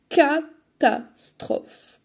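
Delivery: dramatic dismay. A narrator's reading. Grandmother eaten.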